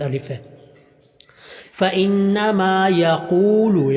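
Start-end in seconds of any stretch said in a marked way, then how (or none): 1.8-3.9 slow, stretched vowels; drawn-out recitation. Shown as a demonstration. Teacher slowly drawing out يقول for students. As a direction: stretch فانما یقول out slowly. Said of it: "یقول کے قاف کو لمبا کریں گے".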